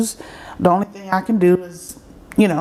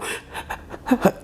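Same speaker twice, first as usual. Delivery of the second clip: soft mimicked breathless sobs; imitated sobbing gasps; 4 semitones higher, 6 dB quieter than usual. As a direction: act out catching your breath while crying. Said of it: "can't catch my breath crying".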